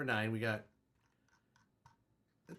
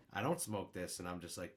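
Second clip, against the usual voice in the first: ordinary talk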